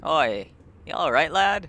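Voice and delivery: sailor voice. Acted. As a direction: hail him like a ship's hand. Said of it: "do the voices the best I can".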